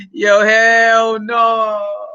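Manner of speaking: very slow, stretched-out refusal; emphatic disbelief. Real, not acted. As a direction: drag out the last word in scorn. Scornful verdict on the sob story.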